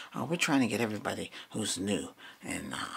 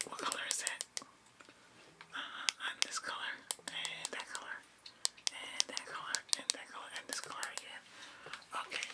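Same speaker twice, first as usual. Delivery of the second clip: whispering; 11 dB quieter than usual. Acted. ASMR roleplay whispering.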